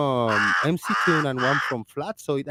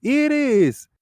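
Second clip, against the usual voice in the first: high-pitched call down the road; imitated street call, pitched high; 11 semitones higher, 3 dB louder than usual. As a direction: call a neighbour's name, much higher. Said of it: "and we called it".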